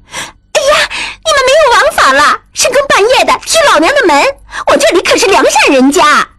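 very high, rapid squawking woman's voice; indignant shrew. Acted. Brothel woman protests at kicked door. (泼辣女人尖声急促地叫嚷)

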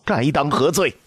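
put-on old empress dowager venting her fury on the ministers; angry scolding. A narrator's reading. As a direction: pitch it up and scold hard. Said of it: "将一腔怒火撒向面前的大臣们".

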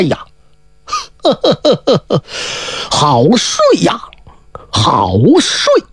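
slow, drowsy stretch into the words; waking with a yawn. A narrator's reading. Ziya waking from death with a lazy stretch. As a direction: sigh it out like just waking. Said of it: "说了个好睡，他伸了个懒腰".

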